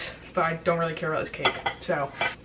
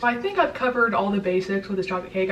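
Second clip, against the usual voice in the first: normal talking voice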